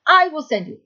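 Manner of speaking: "I" punched high, then falling through the rest; stress on I. Acted. Teacher repeats Pharaoh's words to show the emphasis, stress on I. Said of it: "Look at the emphasis on the word Anochi".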